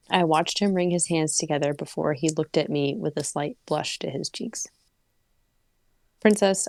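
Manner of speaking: story narration. Acted. Reads the story aloud.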